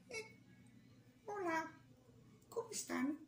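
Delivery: high little rabbit voice saying hello; squeaky rabbit greeting. Acted. Narrator as rabbit greeting listeners, high squeaky voice. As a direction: chirp a bright hello as the bunny.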